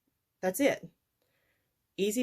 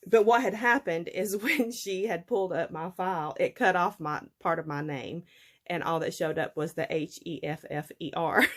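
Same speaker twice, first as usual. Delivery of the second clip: ordinary talk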